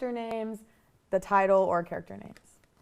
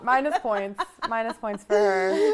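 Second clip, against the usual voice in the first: teasing shout; 9 dB louder than usual. Real, not acted. Teasing penalty.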